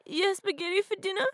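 ordinary talk, nothing marked

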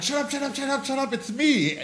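high, urgent hushing; a panicked, high "shut up" to his sister. Retold or imitated. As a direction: frantically hush someone about to scream. Hushing sister in dark room.

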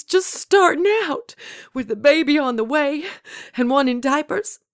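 high, loud, grief-stricken accusation; anguished outburst. A narrator's reading. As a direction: pour out old grief at the one who took him. Old woman confronting Death over husband.

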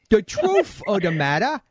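badly faked Cockney, TH turned to F. Shown as a demonstration. Host showing the guest his bad Cockney accent. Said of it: "you drop the T H".

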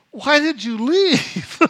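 high, loud, slow bewildered question; puzzled friend. Retold or imitated. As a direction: voice the confused friend, drawn out.

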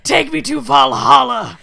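slow, loud, hammed-up heroic shout; drawn-out mock-epic holler; mock battle cry. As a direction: bellow it like a pretend dying warrior. Mock heroic death cry, joking.

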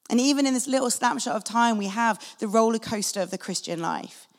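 ordinary delivery

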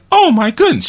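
high, loud mock gasp of shock. Imagining how Adrian might have reacted, mock shocked. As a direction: ham up a prim lady's outrage. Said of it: "And Adrian wasn't like".

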